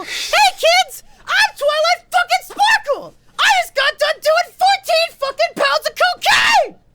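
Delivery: very high, loud screeching character voice; Doctor Roxo-like Twilight Sparkle. Acted. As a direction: screech like a manic cartoon performer greeting kids. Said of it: "why did Twilight Sparkle sound like Doctor Roxo".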